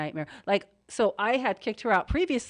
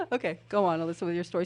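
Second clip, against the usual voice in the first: ordinary talk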